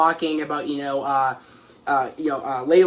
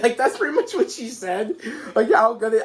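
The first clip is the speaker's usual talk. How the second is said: amused, laughing through the words; real giggly laughter in the voice; 4 semitones higher than usual. Real, not acted. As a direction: try to report it while cracking up. Cracking up at retold question.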